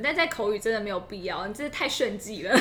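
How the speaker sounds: ordinary talk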